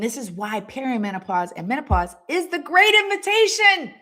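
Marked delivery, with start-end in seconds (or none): 2.3-3.9 raised, ringing high declaration; rousing proclamation. Real, not acted. Coach urging women to welcome menopause, rousing proclamation. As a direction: proclaim it loudly to the whole audience.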